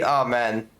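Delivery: usual speaking voice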